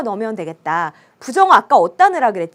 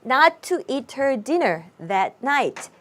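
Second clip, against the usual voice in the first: slow model reading; 4 dB quieter, much slower than usual. Shown as a demonstration. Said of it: "그럼 요렇게 되네".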